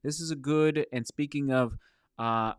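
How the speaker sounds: ordinary talk, nothing marked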